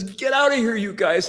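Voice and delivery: high-pitched mock-tearful order; imitated tearful outcry. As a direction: send them away in an imitated, high crying voice. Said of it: "he starts to cry".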